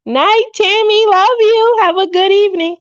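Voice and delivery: high sing-out goodnight; affectionate farewell. Real, not acted. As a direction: call a loving goodbye way up high. Calls goodbye to departing viewer.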